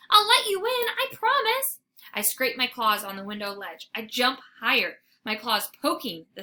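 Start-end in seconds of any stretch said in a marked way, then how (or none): 0.1-1.8 high, loud, eager promise; eager cry. Acted. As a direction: beg with bright urgency. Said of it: "I cry".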